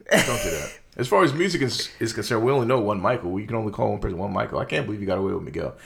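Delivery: ordinary talk, nothing marked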